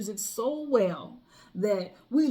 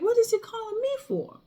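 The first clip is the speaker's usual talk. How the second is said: much higher, baffled question; puzzled inner voice; 13 semitones higher than usual. Retold or imitated. Hearing the DJ call her over, baffled. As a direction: wonder why on earth he's calling you. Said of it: "in my head, I'm like".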